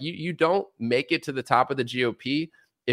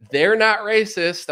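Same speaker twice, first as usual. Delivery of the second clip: mock insistence; 8 semitones higher, 4 dB louder, slower than usual. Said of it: "just to be like".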